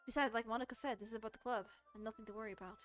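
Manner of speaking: usual speaking voice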